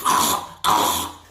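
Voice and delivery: voiceless strangled gasps; mock gasps for air; imitated choking. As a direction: act out being choked from behind. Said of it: "dude was choking him".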